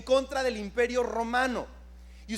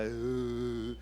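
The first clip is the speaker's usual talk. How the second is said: deep, soft mock boo; low booing grunt; 11 semitones lower, 8 dB quieter than usual. Shown as a demonstration. Preacher showing the congregation how to boo Barrabás, low grunt. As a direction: give a low disapproving "uh" for the congregation to copy. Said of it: "Voltea con su vecino y haga".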